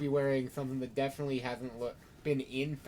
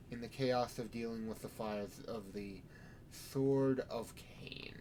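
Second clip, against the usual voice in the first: sermon; 5 dB quieter than usual. Acted. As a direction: preach it with measured pauses. Sheriff preaching to vampires.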